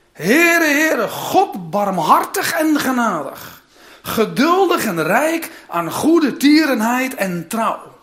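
reading Exodus aloud with solemn force; proclaimed reading. Shown as a demonstration. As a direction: call out the name of the Lord. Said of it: "ik ga het nog een keer voorlezen".

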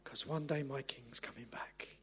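very soft, low, near-whispered promise; hushed whisper. Real, not acted. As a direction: drop to an awed hush for the congregation. Hushed close after loud preaching.

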